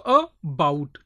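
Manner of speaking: "about" slowly split as a, bout; split into syllables. Shown as a demonstration. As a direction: break "about" into two beats, bout stressed. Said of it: "two syllables are there".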